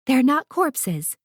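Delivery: snapped. A narrator's reading. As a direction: bite off each word firmly to correct the child. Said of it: "Verity snapped".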